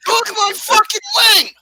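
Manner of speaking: high, loud protest; outraged shout. Real, not acted. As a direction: yell over the ruined wing. Wing was eaten.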